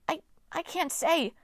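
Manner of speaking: high, slow, halting dwarf voice; stammering. A narrator's reading. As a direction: stumble over the words in fright. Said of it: "Pasilos stammered".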